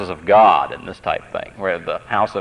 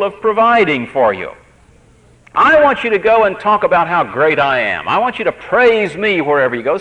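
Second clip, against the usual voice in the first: high, louder voice of God rebuking; voicing God; 9 semitones higher, 4 dB louder than usual. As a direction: speak as God rebuking his people. God's voice voiced in sermon.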